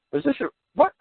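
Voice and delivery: baffled question leaping high at the end; mock disbelief. Agents wondering if the letter is a trap, incredulous. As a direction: imitate someone totally thrown. Acts out suspicious, baffled recipients.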